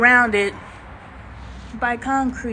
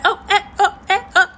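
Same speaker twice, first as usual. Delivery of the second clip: excited squeal; 8 semitones higher, 6 dB louder, faster than usual. Retold or imitated. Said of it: "I'm like".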